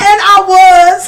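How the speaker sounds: loud, drawn-out words through laughter; laughing confession. Real, not acted. Admitting to viewers she was rushing, laughing. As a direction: stretch it out, cracking up.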